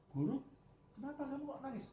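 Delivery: high, anxious question; concerned student. Acted. A student asking his crying teacher why, high and anxious. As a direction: ask the weeping teacher, high and worried.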